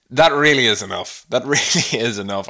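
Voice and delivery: normal talking voice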